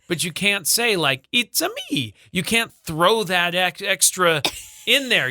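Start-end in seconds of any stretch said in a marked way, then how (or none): 1.3-2.1 high squeaky plumber voice, Italian; Mario voice. Retold or imitated. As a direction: pop into a cartoon Mario voice. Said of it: "Let me see if I can do a Mario voice".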